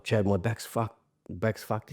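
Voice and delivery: quiet, weary groan of a sore back; pained complaint. Retold or imitated. Injured worker moaning quietly to his boss about his back. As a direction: softly moan to the boss about a wrecked back.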